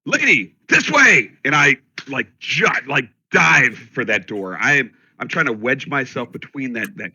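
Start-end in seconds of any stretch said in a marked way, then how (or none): 0.0-1.3 high urgent shout to a lady; calling out. Acted. Player's character calls for a lady at the door, urgent shout. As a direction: yell out loud and high, urgently. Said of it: "I called for her first".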